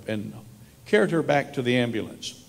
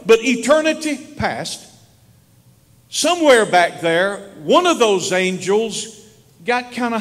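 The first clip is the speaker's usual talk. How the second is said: higher, louder pulpit preaching; 4 semitones higher, 4 dB louder than usual. Acted. Pastor preaching to his church about where the dead go, raised pulpit voice. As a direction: build it up for the congregation.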